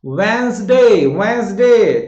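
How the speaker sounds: "वेंसडे" very slow and loud, WENS-daay; loud, drawn-out model "वेंसडे"; drilled Wednesday. Shown as a demonstration. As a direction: stretch "वेंसडे" into two long syllables, silent d. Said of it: "ये silent है".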